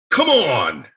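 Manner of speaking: loud, high exclamation of disbelief; exasperated outburst. Retold or imitated. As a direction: cry out at the screen in exasperation. Exasperated at computer while reading.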